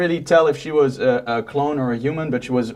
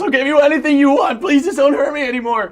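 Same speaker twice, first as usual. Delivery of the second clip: high, fast, desperate begging; pleading scream; 13 semitones higher, 4 dB louder, faster than usual. Acted. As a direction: beg for your life in panic. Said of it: "he just starts screaming".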